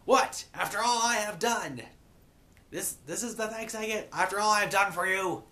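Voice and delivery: high-pitched mock outrage; indignant princess. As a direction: protest like a wronged ruler. Betrayed princess voiced to jeering subjects.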